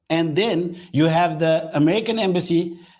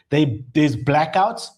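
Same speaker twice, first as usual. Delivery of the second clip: ordinary talk, nothing marked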